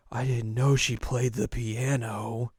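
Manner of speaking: slow, drawn-out put-on disbelief; jealous reaction. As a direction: stretch it out with mock jealousy.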